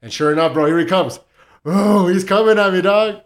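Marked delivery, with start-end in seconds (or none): 1.6-3.2 loud, high storyteller's alarm; retold alarm. Storyteller telling his friend how the dog charged, excited alarm. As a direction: relive the charge, voice jumping up.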